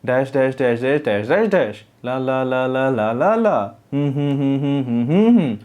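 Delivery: lilting up-and-down singsong on nonsense syllables; sing-song tone. Shown as a demonstration. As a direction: rise and fall the same way every phrase. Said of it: "ये sing song tone में है".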